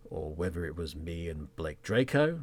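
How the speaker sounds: normal talking voice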